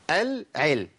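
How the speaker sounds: "العلم" with the l sounded distinctly; clear lam. Shown as a demonstration. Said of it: "ما أقولش أعلم، ما ينفعش".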